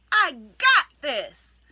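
loud, slow, firmly declared; confident declaration. Shown as a demonstration. Coach showing how to declare an affirmation, loud and slow. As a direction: plant your feet and own it. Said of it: "declare it and mean it".

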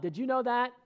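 normal talking voice

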